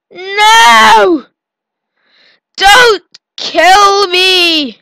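loud high frantic cries; panicked screaming. Real, not acted. Player screams while fleeing a big cell, loud high panic. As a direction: scream as you flee for your life.

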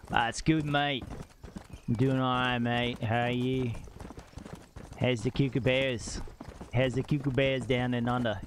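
jokey put-on Australian accent. Streamer joking around as an Australian, put-on accent. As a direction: lay on a playful Aussie twang. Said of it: "why are we Australian today".

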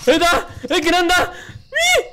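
shocked. Real, not acted. Shocked by sudden event.

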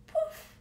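a high, popped "poof" with a burst of air; spring the word "poof" up high like a bubble bursting; playful poof. Acted. Playful magic sound word.

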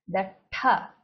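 "tha" pushed out with a puff of breath; aspirated tha. Shown as a demonstration. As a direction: breathe force behind "tha". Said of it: "has a bit of force in it".

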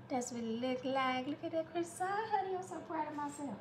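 playfully channel the Southern lady from the movie; southern movie lady accent. Pretend. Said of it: "when I was just talking like that".

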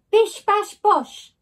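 high, loud chanted kicks; kicking sound effect. A narrator's reading. Narrator voicing the kicks on the door, high and loud. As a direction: bang out each kick.

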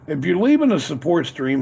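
usual speaking voice

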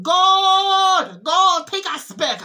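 loud, high cry to be taken back; a tormented soul crying out loud to God; desperate shout. Retold or imitated. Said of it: "and they shouted".

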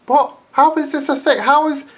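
high, loud outraged rant; angry rant. Real, not acted. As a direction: rant in disbelief. Ranting at the videos.

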